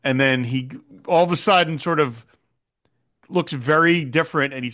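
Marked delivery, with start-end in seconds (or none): none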